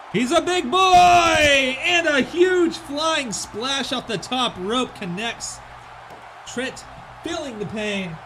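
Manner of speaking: play-by-play wrestling call; wrestling commentator. Acted. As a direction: call the match like a ring announcer.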